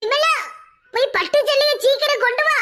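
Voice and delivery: bossy call for the silk saree. Acted. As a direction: call her to fetch it quickly. Calling out to servant.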